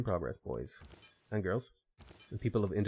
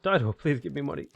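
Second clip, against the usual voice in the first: pleading; 4 semitones higher, 6 dB louder than usual. Pretend. Begging AI leader.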